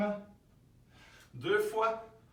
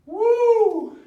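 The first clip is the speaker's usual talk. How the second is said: a high, loud whoop of effort; whooping cheer; 15 dB louder than usual. Real, not acted. Coach whoops to the class at the end of the set, high and loud. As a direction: let out a big whoop as the set ends.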